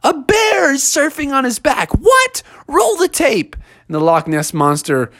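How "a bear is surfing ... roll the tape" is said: put-on high, excited child's voice; a kid squealing with news.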